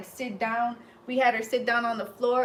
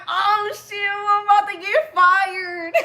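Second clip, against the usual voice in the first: high, loud, laughing panic; panicked laugh; 9 semitones higher, 8 dB louder, slower than usual. Retold or imitated. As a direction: squeal it through nervous laughter. Panicking about being fired.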